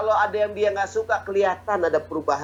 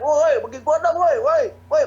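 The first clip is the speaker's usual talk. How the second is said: loud, high yelling; mock village footballer shouting; shouting for the ball; 8 semitones higher, 4 dB louder than usual. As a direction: yell across the pitch for a pass. Amateur player calling for ball.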